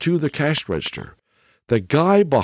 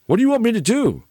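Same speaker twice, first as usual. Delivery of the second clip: a much higher, baffled clerk voice; mimicking confused clerk; 10 semitones higher, faster than usual. Retelling the cashier's puzzled reply, in a much higher voice. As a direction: play the clueless cashier, voice shooting up high.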